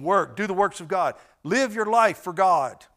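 ordinary talk

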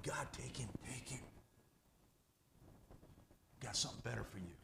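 soft unvoiced plea; whispered prayer. Son by his dying father quietly praying for release.

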